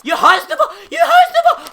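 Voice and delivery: sarcastic laughing disbelief; mock disbelief. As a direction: squeak it out incredulously.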